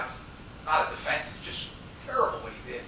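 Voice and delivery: usual speaking voice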